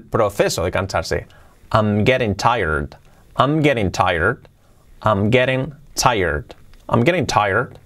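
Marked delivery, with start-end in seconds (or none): 1.7-7.8 slow model phrase. Shown as a demonstration. Teacher slowly models phrase.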